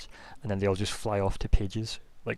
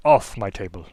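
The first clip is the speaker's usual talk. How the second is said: loud, fussy complaint; peevish old mage pointing at the mess; indignant old wizard; 11 dB louder than usual. Acted.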